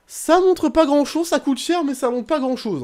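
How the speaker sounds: high, loud mocking complaint; exasperated. Real, not acted. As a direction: scoff at the costly striker. Mocking his expensive striker.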